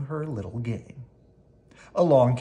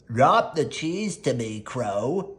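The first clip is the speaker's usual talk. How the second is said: elephant voice; 4 dB louder, slower than usual. Acted.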